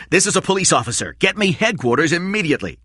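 fast, clipped urgent call. Acted. Corella calls headquarters, fast and urgent. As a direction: rattle off the order quickly.

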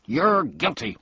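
policeman shouting accusations. A narrator's reading. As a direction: shout it out high and loud. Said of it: "stopping only long enough to shout".

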